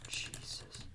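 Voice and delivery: breathless whispered disbelief; stunned whisper. Real, not acted. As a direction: whisper it in shock.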